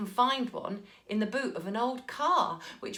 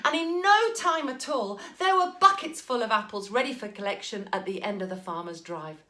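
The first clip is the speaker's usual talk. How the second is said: ordinary delivery, nothing marked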